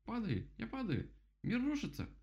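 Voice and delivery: очень высокий панический птичий голос; причитай всё выше, в панике; паникующий дятел. A narrator's reading. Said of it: "закричал дятел". (панически, голосом дятла)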